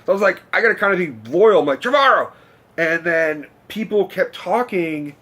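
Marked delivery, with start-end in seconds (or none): 1.8-2.3 high, loud call-out of a name; imitating an audience heckle; retold yell. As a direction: holler it out loyally to the stage. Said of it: "kinda yelled out".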